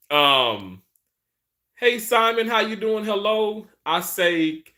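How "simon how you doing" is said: ordinary delivery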